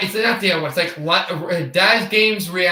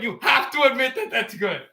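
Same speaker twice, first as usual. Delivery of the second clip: mock British; 5 semitones higher, 3 dB quieter than usual. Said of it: "doesn't speak a British accent".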